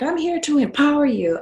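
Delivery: high, loud parody of a slogan; mock empowering photographer. Fellow boudoir photographers pitching clients, mock empowering.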